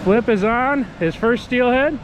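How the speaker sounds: thrilled shout that he's hooked; fighting a fish. Real, not acted. Son hooks his first steelhead.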